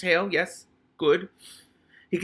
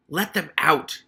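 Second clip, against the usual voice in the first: put-on angry rat shouting up at the boy; a sharp raised command; shouted; 4 semitones higher, 7 dB louder, slower than usual. Hammond Pork shouting up at Keith to free the rats. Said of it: "he shouted up to Keith".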